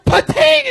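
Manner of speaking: very high, loud imitated game show sound; imitated survey buzzer. Comic imitates what the survey goes, piercing sound effect. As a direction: mimic the board's ding, high and loud. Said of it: "And then the survey goes".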